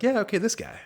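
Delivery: high, loud, eager yes-man voice; a studio exec jumping at the director, high and loud. Retold or imitated. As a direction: snap up the guy like a studio exec. Studio execs pick the director.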